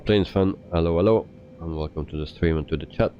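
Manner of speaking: normal talking voice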